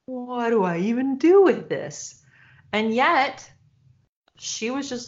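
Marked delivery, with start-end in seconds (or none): 0.1-2.2 retold bewilderment. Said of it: "so out of character. I was like".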